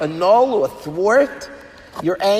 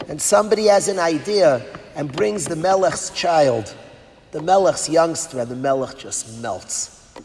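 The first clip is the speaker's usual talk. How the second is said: ordinary talk, nothing marked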